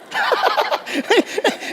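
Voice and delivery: a high, airy burst of laughter at his own joke; high breathy laugh. Real, not acted. Preacher laughing at his own story, high airy laugh.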